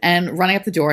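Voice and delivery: ordinary delivery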